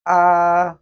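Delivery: AH held high, level and loud; sing-steady high flat AH, no glide; first tone. Shown as a demonstration. Teacher models the first tone, high level AH. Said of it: "pronounced with the upper range".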